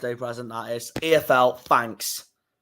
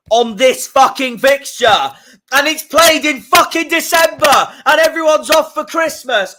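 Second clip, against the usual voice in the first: higher and louder, building fury; angry rant; 11 semitones higher, 6 dB louder than usual. Real, not acted. Fan ranting to viewers about a December fixture, furious. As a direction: spit out the swearing in rising anger.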